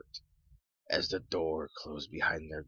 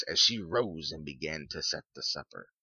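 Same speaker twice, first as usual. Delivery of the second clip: ordinary delivery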